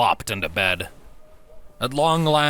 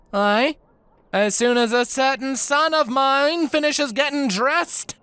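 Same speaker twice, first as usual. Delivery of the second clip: raised voice. Acted. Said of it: "raising her voice for the end".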